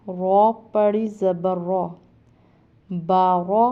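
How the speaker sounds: ordinary delivery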